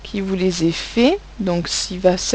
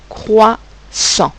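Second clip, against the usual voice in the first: CROISSANT in two separate beats, crois, pause, sant; dictated by syllables; 3 dB louder than usual. Shown as a demonstration. Teacher dictating words to pupils, dictated by syllables. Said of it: "on dit les mots".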